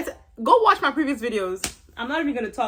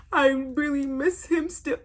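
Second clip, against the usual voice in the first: slow, higher-pitched mock sob; mock crying; 4 semitones higher, slower than usual. Vlogger mimicking the girl who misses him, mock bawling. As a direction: imitate a tearful wail, drawn out. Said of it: "This girl starts bawling, crying".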